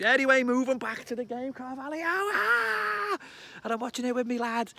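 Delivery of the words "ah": high, loud cry of joy; excited yell. Real, not acted. Fan yelling as he remembers the goal. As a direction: burst out like the goal just went in.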